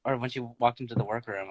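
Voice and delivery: ordinary delivery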